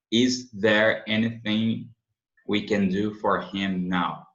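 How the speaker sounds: Is there anything we can do for him now, very slow; slow model read. Shown as a demonstration. Teacher modelling the question slowly for students. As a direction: read the question at half speed. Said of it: "slow model question".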